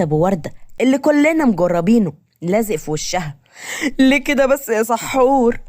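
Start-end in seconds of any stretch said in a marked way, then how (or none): 4.0-5.6 high, drawn-out whine of complaint; wounded protest. Acted. Daughter just hit by mother's slipper, wounded protest. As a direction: stretch it out, pitched up and aggrieved.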